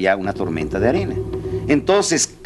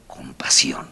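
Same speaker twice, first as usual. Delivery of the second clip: whispered refrain; 11 semitones lower, 4 dB quieter, slower than usual. Shown as a demonstration. Speaker to the audience, whispering "con pasión" as a climax. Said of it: "refrain dropped to whisper".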